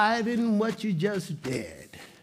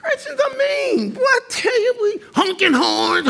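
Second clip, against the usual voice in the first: high, laughing, half-crying exclamation; a shouted, laughing wail about mean drivers; 4 dB louder than usual. Real, not acted. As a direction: laugh it out in mock despair. Laughing exasperation over traffic.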